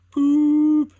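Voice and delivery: a shrill train whistle, full blast; high loud whistle poop. A narrator's reading. Engine whistling as it charges joyfully, loud high toot. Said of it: "went Ivor's whistle".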